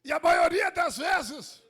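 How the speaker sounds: building to loud shouted preaching; fiery sermon shout; preaching at full force. Acted. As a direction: preach it out, rising to a roar.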